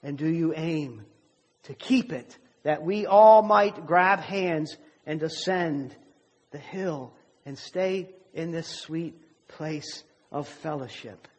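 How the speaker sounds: preaching appeal. Acted. Preacher closing the sermon to his church, rising appeal. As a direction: press the question on the congregation.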